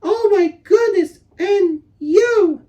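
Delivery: put-on very high, gushing girl's voice; high, breathless girl's voice, thrilled and fussing; delighted gushing. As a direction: gush over the lost pet in delight. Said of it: "said things like".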